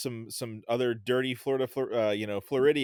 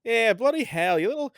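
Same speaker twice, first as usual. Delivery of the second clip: loud, high-pitched mock Australian, falling at the end; Australian accent; 11 semitones higher, 6 dB louder than usual. Shown as a demonstration. Podcast guest teases host in Aussie slang, mock Australian accent. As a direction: exaggerate an Australian accent with big pitch swoops. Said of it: "I can do a good Australian accent".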